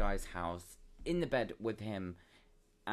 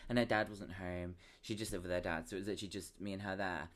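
usual speaking voice

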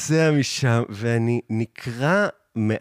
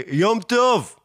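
high-pitched re-enacted holler; mock calling out; 9 semitones higher than usual. Calling to the hipster across the crossing, mock yell. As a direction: call it across the road, pitched up without full volume. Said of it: "אני כזה צועק לו כבר".

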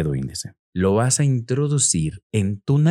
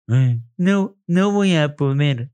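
slowed, dopey finger-in-nose voice; lightly voiced nitwit; imbecile voice; slower than usual. Shown as a demonstration. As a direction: say it slowly like a fool with a finger up the nose. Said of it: "con voz de imbécil".

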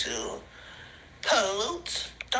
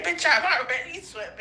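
ordinary talk, nothing marked

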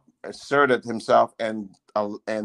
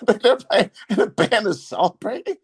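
laughing; 8 semitones higher, 3 dB louder than usual. Real, not acted. Laughing while recalling the band.

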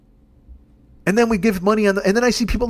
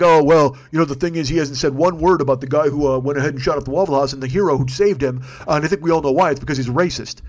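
lightly voiced complainer, pitched lower; mock complainer; 6 semitones lower than usual. Host imitating online critics of Trump, in a lower mock-complainer voice. Mimics people griping about Trump's silence.

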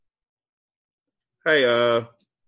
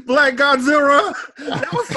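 laughing; 13 semitones higher, 4 dB louder than usual. Real, not acted. Laughing recalling Godzilla.

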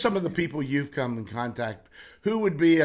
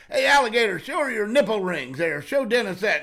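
retold loud, much higher call; 7 semitones higher, 5 dB louder than usual. Tom urges a carnival woman to show off, loud call. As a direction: shout it across to her, egging her on.

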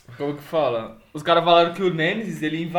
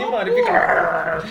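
startled; much slower than usual. Retold or imitated. Retelling a scare, voice jumps.

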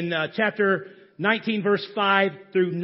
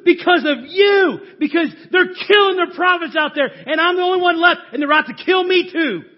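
loud, higher, shouting accusation; angry complaint; 7 semitones higher, 6 dB louder than usual. Acted. Elijah shouting his complaint at God, furious. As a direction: throw the blame at God at full volume. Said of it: "He's complaining to God. He's arguing with God".

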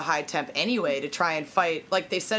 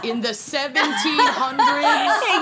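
laughing; 7 semitones higher, 5 dB louder, slower than usual. Real, not acted. Joking about a smelly man.

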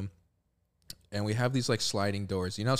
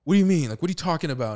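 loud, high-pitched disbelief; imitated outburst, starting loud and high; mock indignant principal; 7 semitones higher, 8 dB louder, faster than usual. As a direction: challenge it like a disbelieving vice principal. Disbelieving vice principal, retold.

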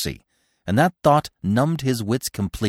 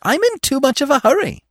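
very high, louder sing-out taunt; teasing; 5 dB louder than usual. A narrator's reading. Feline bounding away from Bambi, teasing. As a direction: call back teasingly, pitched high. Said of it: "teased Feline".

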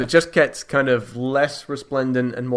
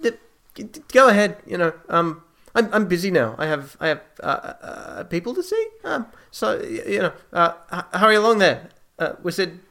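high, stammering, evasive host; Apollo, flustered; 9 semitones higher than usual. Acted. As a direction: brush it off nervously in a high voice, tripping over words. Apollo nervously explains noises away.

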